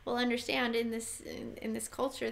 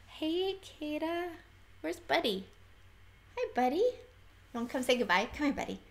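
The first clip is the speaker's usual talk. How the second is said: pet talk; 5 semitones higher than usual. Real, not acted. Calling the dog over.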